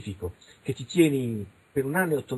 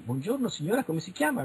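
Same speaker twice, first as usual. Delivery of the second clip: lightly acted interviewer, pitched higher; mock investigator; 7 semitones higher than usual. Investigator questioning a witness, lightly mocked interviewer voice. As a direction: imitate a brisk official greeting. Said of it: "fanno le indagini".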